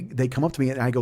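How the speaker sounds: usual speaking voice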